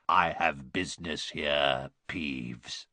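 low, slow ghostly croak. A narrator's reading. Harry posing as Baron, low croak. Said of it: "croaked Harry".

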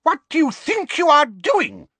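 very high, loud, scandalized screech; outraged shriek. A narrator's reading. Librarian catching students with food, shrieking. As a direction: shriek at rule-breakers in a piercing high voice. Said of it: "she screamed".

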